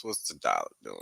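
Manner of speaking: a low, quiet growl of a question; goofy deep-voiced aside to viewers; mock deep voice. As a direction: drop the voice way down and soften it, playful. Mock deep voice before charts.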